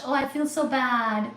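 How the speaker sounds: ordinary talk, nothing marked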